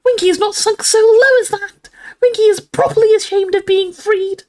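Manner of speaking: very high, loud indignant squeak; furious squeaking elf. A narrator's reading. Winky declares her shame, furious squeak. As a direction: squeak it out in wounded fury. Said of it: "she squeaked".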